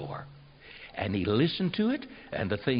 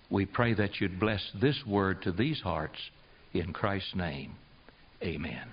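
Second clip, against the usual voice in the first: prayer; 3 semitones lower than usual. Acted. Preacher leading the congregation in closing prayer, low and hushed.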